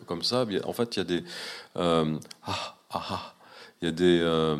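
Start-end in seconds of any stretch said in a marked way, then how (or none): 2.4-3.3 "ah, ah, ah" as separate breathed bursts; marked sound breaks. Shown as a demonstration. Showing strongly accented Tahitian breaks on "ah, ah, ah", each cut off. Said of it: "des accents qui sont très marqués en rupture de son".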